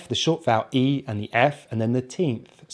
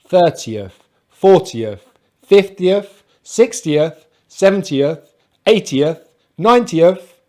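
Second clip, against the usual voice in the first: front syllable hit in "thirtieth", "fiftieth", "ninetieth"; stress on first part; 4 semitones higher, 4 dB louder, slower than usual. Shown as a demonstration. Teacher drilling the pattern for learners, first part stressed as in "FORtieth". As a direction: list them like "FIFtieth", first part punched. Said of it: "we stress the first part of the number".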